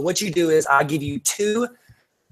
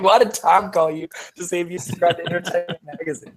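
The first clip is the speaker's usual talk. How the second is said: mock confused self. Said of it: "I was like".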